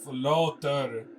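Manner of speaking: put-on Russian-accented call. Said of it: "interrupted her voice".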